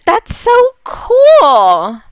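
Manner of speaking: high, drawn-out delight; excited. Real, not acted. Gamer tells viewers the game is so cool, drawn out and thrilled. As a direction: gush over the game.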